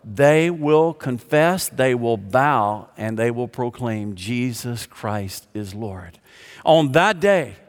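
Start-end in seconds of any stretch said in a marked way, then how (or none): 0.1-6.2 slow solemn climax. Acted. Preacher describing every knee bowing, slow and solemn. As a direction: let each phrase land slowly and fall away.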